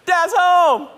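put-on high, loud kid's announcement; excited child. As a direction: call it through the house like an eager child. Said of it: "the sound of them excited I'm home".

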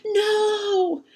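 sudden high shouted no; cry of no. Acted. Girl crying out to stop Papa cutting the tree, shouted. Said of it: "A voice splits the stillness".

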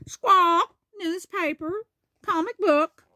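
high squawking parrot voice; Polly. Acted. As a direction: squawk loudly, then parrot the words back high. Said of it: "Polly repeats".

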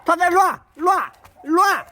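loud call to the dog. Real, not acted. Owner calling his dog loudly. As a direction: call the dog's name across the yard.

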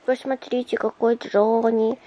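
ordinary talk

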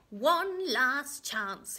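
slow, loud, high croak; croaking warning. A narrator's reading. Little man warns the queen, croaking. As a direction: stretch each word out in a croak. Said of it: "he croaked".

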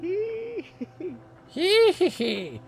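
slow high little hee-hee giggle. Acted. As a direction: giggle like a sprite. Sleeping scene, cute giggle.

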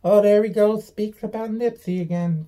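taunting imitation of people who mock him; mocking critics. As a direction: parrot the critics in a squeaky sneer.

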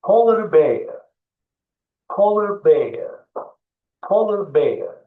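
polar bear read clearly, polar stressed; teacher reads polar bear; model pronunciation. Shown as a demonstration. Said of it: "okuyorum kutup ayısı ne demek".

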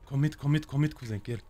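ordinary talk

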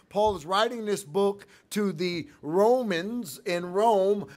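loud, driving pulpit delivery; full-force preaching. Acted. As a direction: preach it out to the back pew.

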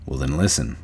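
low, soft command; low, controlled authority; quiet warning. A narrator's reading. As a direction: make him listen without raising your voice. Slim quietly warns Curly.

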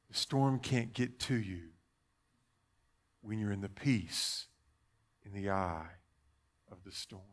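soft, low, fading away; hushed reassurance. Acted. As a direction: settle listeners into peace. Soothing close of reassurance.

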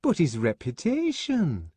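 high, shocked woman's voice; scandalized protest. A narrator's reading. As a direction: object in horror at the gossip. Fanny is scandalized.

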